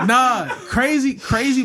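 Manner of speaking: high, loud, through laughter; laughing denial. Real, not acted. Storyteller brushing off the host's joke, laughing. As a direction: laugh it off emphatically.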